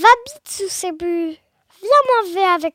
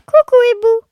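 high, loud call to the owl; salut lancé; 4 semitones higher, 8 dB louder, faster than usual. Acted. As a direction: call out in a pitched-up sing-out. Mouse hails the owl. (salut aigu et sonore)

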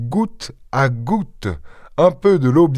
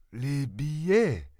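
softer, slow, puzzled echo; the old beggar feigns not understanding, echoing softly; dumb echo; 12 dB quieter, much slower than usual. A narrator's reading. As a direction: play the fool, repeating it slowly and softly. Beggar echoes, feigning ignorance.

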